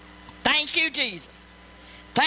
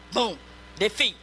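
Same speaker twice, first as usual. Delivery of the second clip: ordinary talk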